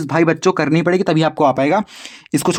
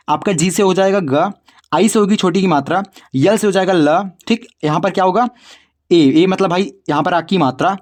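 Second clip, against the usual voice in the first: normal talking voice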